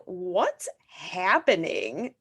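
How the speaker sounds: very slow, stretched-out bewildered question; baffled dad. Retold or imitated. Dad hearing his child's new accent, slow bewilderment. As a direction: draw it out in mock bafflement. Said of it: "my dad was like".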